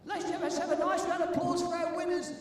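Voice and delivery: loud, high MC announcement to the hall; announcer shout. Real, not acted. MC calling out the winners to the crowd, loud announcer voice.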